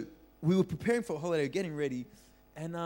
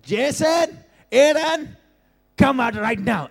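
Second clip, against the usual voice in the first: high, loud furious summons; screaming uncle; 10 semitones higher, 12 dB louder, slower than usual. Retold or imitated. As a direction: bellow the names like an angry uncle. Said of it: "screaming his head off".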